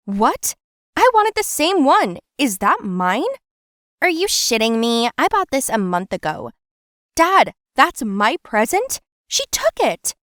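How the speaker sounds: high, loud outraged shrieks; shocked outrage. Acted. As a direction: blurt out your hurt disbelief at the gift. Discovers sister got her present.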